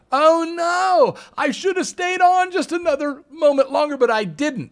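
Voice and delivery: hammed-up panic at missing the rebound; whiny regret. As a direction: wail it in a squeaky voice of regret. Retold regret of little investors.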